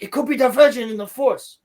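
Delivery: high, loud mock foreign accent; Sol impression. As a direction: imitate someone new to English, accent laid on thick. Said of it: "he just learned English".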